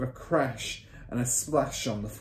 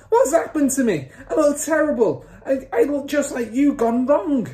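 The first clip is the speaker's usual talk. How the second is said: high, loud horrified wail; father's yell; 9 dB louder than usual. A narrator's reading. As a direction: yell in panic at the mirror. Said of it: "yelled the father".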